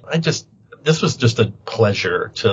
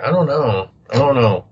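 ordinary talk

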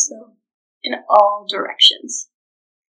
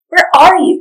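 loud, high shout of the ogre; 6 semitones higher, 8 dB louder than usual. Acted. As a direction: bellow the question across the room. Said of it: "he shouted".

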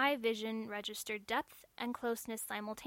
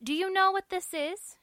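pointed question; 9 semitones higher, 9 dB louder than usual. Acted. Registrar questions robot, pointed.

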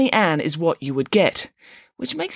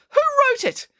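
very high, outraged demand; scandalised, high-pitched question; indignant denunciation. A narrator's reading. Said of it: "she denounced".

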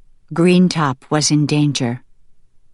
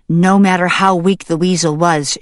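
ordinary delivery, nothing marked

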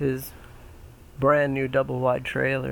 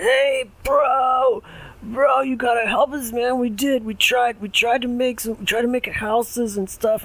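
frat bro; 10 semitones higher, 3 dB louder than usual. Acted. Pig two begs pig three.

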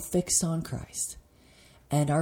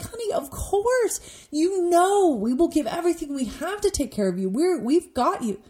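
put-on much higher, tender reassurance to a child; reassuring mother; 12 semitones higher than usual. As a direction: soothe a frightened child like a loving mom. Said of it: "What if your child came into you".

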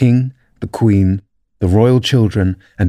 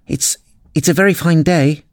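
high, hesitant small talk; meek high-pitched opener; timid voice; 6 semitones higher than usual. A narrator's reading. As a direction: stammer it nervously in a high voice. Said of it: "said a timid voice".

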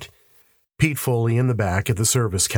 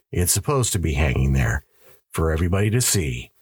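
composed statement in a lowered pitch; calm confirmation; 2 semitones lower, faster than usual. Acted. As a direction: confirm it evenly, as official fact. Cop calmly confirms in same words.

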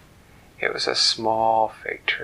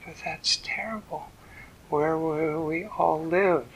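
bright fairy; 5 semitones higher than usual. Acted. The fairy pats the trunk and cheerfully answers the tree. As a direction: chirp it out, pleased with herself.

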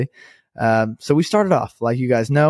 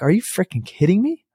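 higher-pitched mock outrage; incredulous; 7 semitones higher than usual. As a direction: retell it as a disbelieving protest. Retelling his disbelief at the price.

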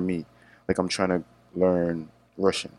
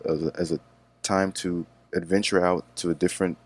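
usual speaking voice

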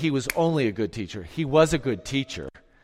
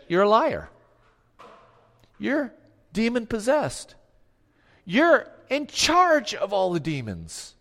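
accusing; 7 semitones higher than usual. Retold or imitated. Crowd denounces Jesus, scornful and raised. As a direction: spit the accusation at him.

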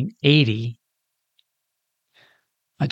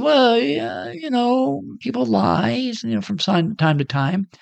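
much higher, strained casual cover-up; forced cool; 10 semitones higher, slower than usual. Retold or imitated. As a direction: panicky but faking nonchalance, pitched way up. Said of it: "tried to still keep my cool".

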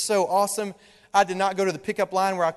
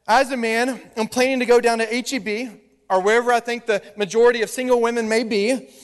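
ordinary talk

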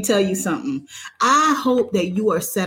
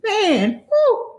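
excited whoop; 13 semitones higher than usual. Real, not acted. Host cheering as she brings her cousin on, high whoop. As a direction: whoop like a cheerleader hyping the crowd.

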